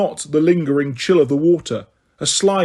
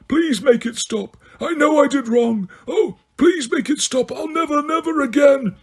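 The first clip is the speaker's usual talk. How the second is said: pleading; 10 semitones higher than usual. A narrator's reading. Dumbledore begging under the potion.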